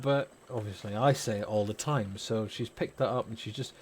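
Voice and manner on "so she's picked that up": ordinary delivery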